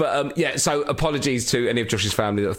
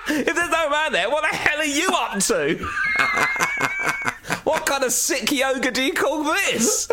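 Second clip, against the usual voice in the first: laughing accusation; 14 semitones higher than usual. Pretend. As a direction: accuse him while cracking up.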